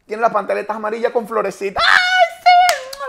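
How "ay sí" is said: put-on much higher and louder shrieking girl voice; squealing woman.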